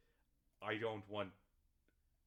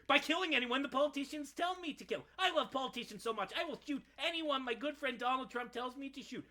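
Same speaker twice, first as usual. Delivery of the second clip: a much higher, loud, cartoonish parody of a gung-ho soldier; mock soldier; 9 dB louder than usual. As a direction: sneer at the obedient soldier with a silly voice. Mocking soldiers' self-justification.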